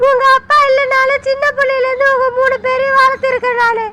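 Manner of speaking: mother weeping and shouting at her accusing son; anguished crying. Acted. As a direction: cry out, wounded, breaking into a shout. Mother hurt by son's accusation.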